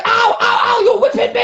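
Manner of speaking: high mock cries of pain; hammed-up high wail; pained yelping. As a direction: imitate a victim yelping under blows. Imitating Maximo being whipped.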